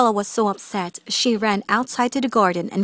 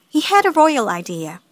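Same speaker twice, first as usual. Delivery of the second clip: usual speaking voice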